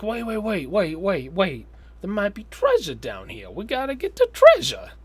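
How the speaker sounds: high, excited mock New York accent; mock New York sewer worker. Sewer worker urging his buddy on, mock-excited New York voice. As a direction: imitate an excitable New Yorker, voice jumping up high. Acting out film's New Yorkers.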